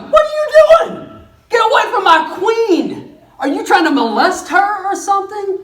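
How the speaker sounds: bursting in on a man at your wife's feet; furious king. Acted. King finds Haman at the queen's couch, shouting. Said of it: "the king walks in and is like".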